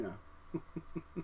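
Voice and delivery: soft laughing trail-off. Real, not acted. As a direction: laugh through the words. Laughs at his own joke.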